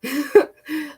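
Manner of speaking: high, mock-amused laugh; a short laugh at a silly twig; retold laugh. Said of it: "And I laughed".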